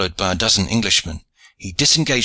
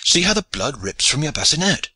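high-pitched urgent remonstrance; insistent, higher voice; urgent warning; 7 semitones higher than usual. A narrator's reading. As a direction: point out the danger, pitch raised. John urgently holding back wounded comrade.

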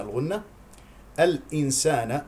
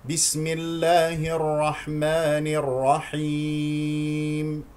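slow, drawn-out tajweed recitation; Quran recitation; 3 semitones higher, much slower than usual. Shown as a demonstration. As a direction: recite the basmala slowly and evenly. (تلاوة مرتلة بطيئة)

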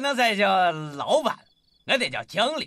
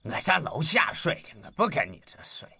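usual speaking voice

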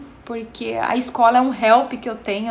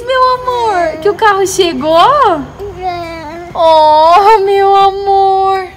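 tender excited cooing; 7 semitones higher, 9 dB louder, slower than usual. Real, not acted. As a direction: mom cooing to her son. Mom cooing to happy child.

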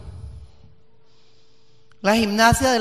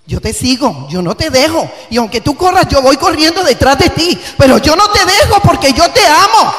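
starts firm and climbs to a full, much louder, higher cry; rising preaching shout; 7 semitones higher, 9 dB louder than usual. Acted. Preacher shouting a mother's vow to her child, building to a roar. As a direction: preach it harder with every line until you are shouting.

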